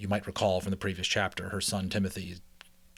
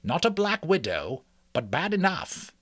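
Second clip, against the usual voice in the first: lightly voiced old rat, higher; a hint of a grave old doctor, pitched up; old Mister Ages; 10 semitones higher, 4 dB louder than usual. Mister Ages judging the spider bite, lightly voiced, higher.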